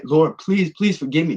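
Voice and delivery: reliving a desperate plea; begging. Retold or imitated. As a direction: plead urgently, pitch lifted. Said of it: "I'm begging".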